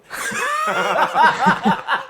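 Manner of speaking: loud high burst of laughter; big laugh. Real, not acted. Laughing at the story.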